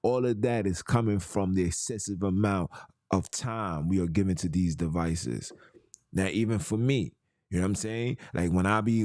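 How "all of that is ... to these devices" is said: ordinary talk, nothing marked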